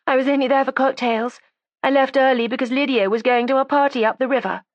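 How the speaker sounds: put-on high, defiant young woman; resentful young woman. A narrator's reading. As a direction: voice her as a sulky, stubborn girl. Judy's voice again.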